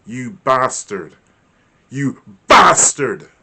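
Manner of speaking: slow, swelling to a loud yell; building anger. Shown as a demonstration. Teacher shows the same insult rising to fury. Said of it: "Can you hear the different tone and pitch".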